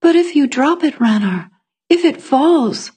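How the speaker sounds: high, worried plea; anxious uncertainty. A narrator's reading. Etta warning Ranar about dropping the laundry, anxious uncertainty. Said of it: "Etta bit her lip in anguished uncertainty".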